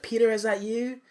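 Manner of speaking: high, tentative imitated woman; coming to. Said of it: "coming to".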